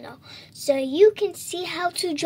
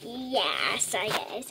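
ordinary talk, nothing marked

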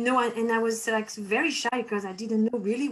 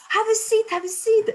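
high, bright and louder, quoting the captain; eager invitation; 11 semitones higher, 6 dB louder than usual. Retold or imitated. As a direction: repeat the offer with excited lift. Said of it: "She said".

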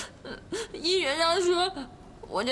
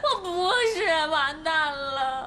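crying despair. Acted. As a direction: whimper the hopeless words. Caomei breaks into crying.

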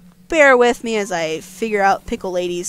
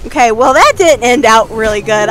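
usual speaking voice

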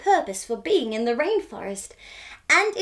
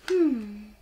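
plain reading voice

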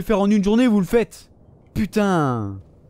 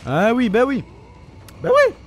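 mock agreement jumping very high; incredulous "oh sure"; sarcastic disbelief; 3 semitones higher than usual. Real, not acted. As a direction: squeak the last repeat in exasperation. Sarcastic reaction to game enemy.

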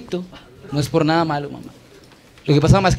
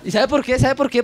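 normal talking voice

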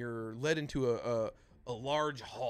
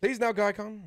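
high, loud in-character request; raised voice, pressing a polite order; the Jackal; 9 semitones higher, 8 dB louder than usual. Acted. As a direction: call on a companion to act. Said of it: "As the Jackal".

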